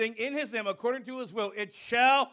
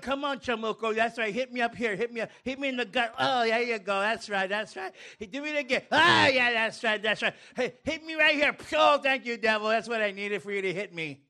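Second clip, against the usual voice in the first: taunting the devil. Acted. Mocking the devil in a scene.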